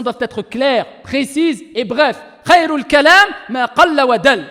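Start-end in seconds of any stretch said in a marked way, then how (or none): 2.4-4.4 quick recited Arabic maxim; Arabic recitation. Acted. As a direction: recite the Arabic saying briskly. (récitation rapide en arabe)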